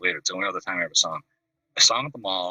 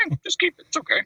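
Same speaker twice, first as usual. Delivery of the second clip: much higher, mock rock-star greeting; imitating a rock singer. Rock star walks into the house, greets him in a high mock voice. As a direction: pitch the greeting way up like a famous singer.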